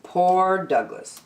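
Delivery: plain reading voice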